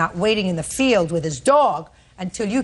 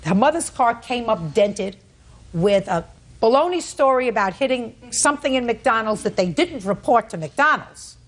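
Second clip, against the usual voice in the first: ordinary talk, nothing marked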